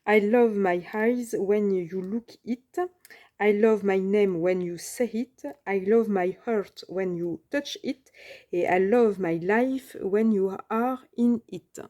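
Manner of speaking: slow English read with a thick French accent; clumsy French-accented English; rotten English accent. Real, not acted. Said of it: "mon accent anglais, euh, tout pourri".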